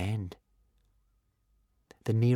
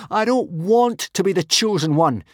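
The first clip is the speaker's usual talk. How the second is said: high, loud anguished howl; 9 semitones higher, 6 dB louder than usual. A narrator's reading. Hiccup howls at the gods above, furious. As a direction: hurl it at the sky. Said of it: "he howled at the blue sky above".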